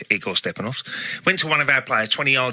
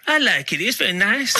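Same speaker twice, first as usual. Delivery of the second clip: put-on light Borat-style accent, higher and laughing; Borat impression; 9 semitones higher than usual. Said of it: "he talked like Borat".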